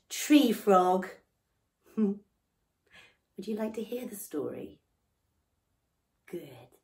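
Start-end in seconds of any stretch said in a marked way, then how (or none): 3.4-6.8 soft unvoiced whisper to the children; storyteller whisper. Whispering to children before the story. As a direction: lean in and whisper the question. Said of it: "whispers to children".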